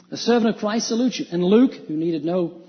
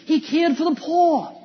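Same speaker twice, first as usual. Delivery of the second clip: slow, raised, ironic take on a false claim; mock defense; 6 semitones higher, slower than usual. Preacher voicing a skeptic's defense of Judas, ironic. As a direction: say it as the naive objector would. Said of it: "No, he didn't".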